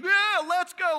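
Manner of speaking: high, loud hyped-up rally cry; rousing anthem. Acted. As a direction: shout it like a team anthem. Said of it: "that's, like, our anthem".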